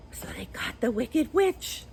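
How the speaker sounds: whispered aside. Real, not acted. Teacher whispers to the class about the witch. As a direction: breathe it to the class.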